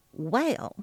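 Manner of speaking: plain reading voice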